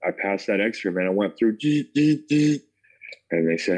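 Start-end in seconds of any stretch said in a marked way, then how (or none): 1.6-2.6 high, loud buzzing noise; imitated machine buzz. Traveler mimicking the X-ray he went through, high buzzing. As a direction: buzz three short high pulses like a machine.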